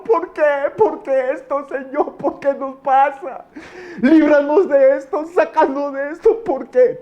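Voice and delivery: high, wailing prayer; tearful pleading. Acted. Brother in the ambulance begging God for rescue, tearful wailing. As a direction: plead in anguish, pitched high and drawn out. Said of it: "pero llorando, llorando".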